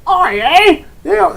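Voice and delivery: loud, high Cockney yell; over-the-top Cockney outburst; Cockney accent. Shown as a demonstration. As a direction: shout it in broad Cockney. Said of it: "don't go directly to Cockney".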